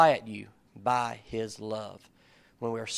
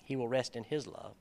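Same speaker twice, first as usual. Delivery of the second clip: softer, understated repeat of the verse; quiet musing repeat; 8 dB quieter than usual. Shown as a demonstration. Preacher repeating a verse he found interesting, soft and reflective. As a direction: say it softly, as if turning it over.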